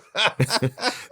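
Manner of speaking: high, bursting laugh; burst of laughter. Real, not acted. Laughing at the boyfriend's answer.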